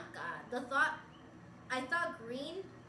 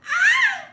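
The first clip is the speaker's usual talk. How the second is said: very high, loud seagull squawks; seagull cry; 16 dB louder than usual. Acted. Streamer doing a seagull on request, loud high screech. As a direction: screech out piercing seagull calls. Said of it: "do a seagull".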